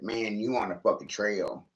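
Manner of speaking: ordinary talk, nothing marked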